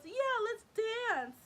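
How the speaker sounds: high, loud, drawn-out imitation of an eager friend; over-keen girly cheer; mock eagerness. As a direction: squeal it slowly, mocking her keenness. Friend eagerly agrees to dance.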